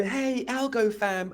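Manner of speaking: high, slow, sneering "Hey, Algo fam"; mocking wannabe greeting. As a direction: drag it out like a try-hard poser. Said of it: "and then they go".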